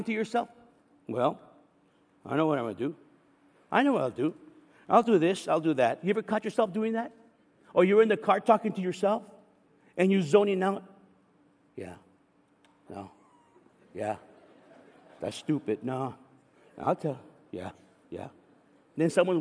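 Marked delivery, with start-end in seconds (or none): 1.1-6.0 low, muttered inner voice; talking to yourself. Retold or imitated. Person alone, plotting aloud in a low voice. 11.8-18.3 low, soft mumbled yeahs; absent-minded mumbling; zoned-out self-talk. Retold or imitated. Driver alone in the car, mumbling softly. Zoning out talking to yourself.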